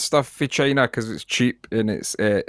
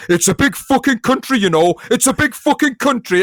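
much higher and louder, mock-indignant yell; indignant shout; 12 semitones higher, 5 dB louder than usual. An English tourist shouting at a Turkish cabaret act, indignant and much louder. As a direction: bellow it across the bar. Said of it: "this English guy shouted".

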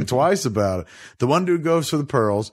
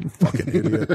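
mock scolding; 4 semitones higher than usual.

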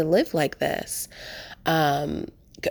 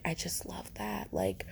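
whisper; 13 dB quieter than usual. Real, not acted. Aside about loving Chicago transit.

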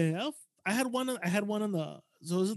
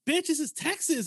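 high-pitched, punchy dismissal; brash retold comeback; 9 semitones higher than usual. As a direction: imitate a cocky local setting him straight. Retells in-law's brash comeback.

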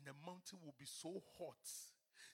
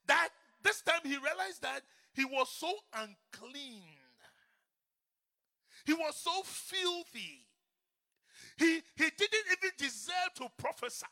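full-force preaching; 10 semitones higher, 15 dB louder than usual. Acted. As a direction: drive it home to the crowd.

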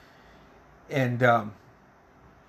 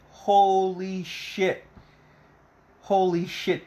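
high, slow, stretched-out exclamation; drawn-out amazement; 6 semitones higher, much slower than usual. Real, not acted. Fan reacts as Cruel Summer starts playing, drawn-out amazement. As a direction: draw it out in awe. Awed at song starting in film.

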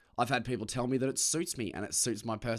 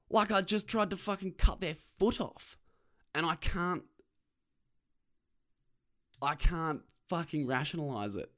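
usual speaking voice

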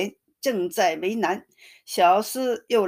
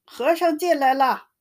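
high, raised shout of alarm; 7 semitones higher than usual. A narrator's reading. Jia Lian shouting after the monk, warning the women, raised alarm. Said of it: "跟在后面嚷".

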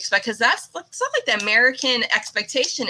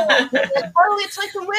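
high, laughing squeal of delight; 12 semitones higher, slower than usual. Real, not acted. Host gushing about the Wiggles, high delighted squeal. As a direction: squeal it like a thrilled fan.

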